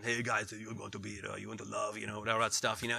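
low mock Bond villain voice; Klaus Schwab. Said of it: "he sounds like a Bond villain".

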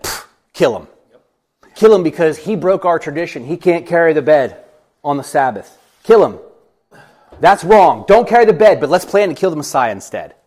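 loud, high indignant condemnation; outraged leaders. Acted. Religious leaders condemning the Sabbath breaker, loud mock outrage. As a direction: thunder out a mock-outraged verdict.

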